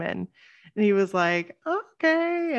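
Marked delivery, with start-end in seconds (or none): 1.7-2.5 high, drawn-out, unsure okay; hesitant okay. Retold or imitated. Singer answering a podcast pitch, hesitant. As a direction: imitate someone warily going along.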